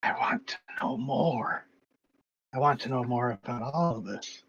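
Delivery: reedy whispered voice; an eerie voice in the head; thin reedy voice. Acted. The same entity voice continues.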